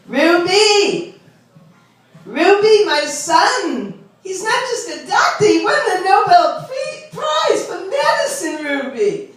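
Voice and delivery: hammed-up Long Island mom bragging; shrieking about her son's Nobel Prize; gossiping Long Island mother. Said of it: "This is how they really talk".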